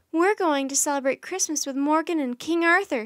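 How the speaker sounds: loud, high, excited outburst; thrilled announcement. Acted. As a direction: announce a treat with glee. Annie thrilled about the trip.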